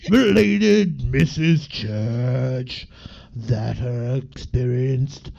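slow, growled [name] reading voice; [name] impression. Reading a mystery book aloud as [name] on request. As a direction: drag every phrase out like [name]. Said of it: "read it in a [name] accent".

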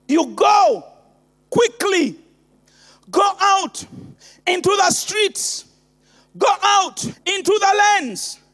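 urgent preaching. Acted. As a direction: push them out the door with urgency. Preaching the command to congregation.

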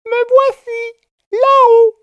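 very high, tiny voice calling down; the cricket calls down from the ceiling. A narrator's reading. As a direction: announce yourself from the beam.

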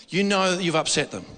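ordinary talk, nothing marked

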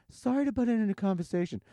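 high, sugary apology; fake-polite interruption, up high; intrusive lady. Restaurant lady butting into their talk, high and sweet. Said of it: "This lady turns around and goes".

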